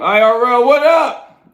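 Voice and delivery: loud, high shout-out greeting; calling out. Real, not acted. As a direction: holler it up high to a viewer. Greeting a viewer by name.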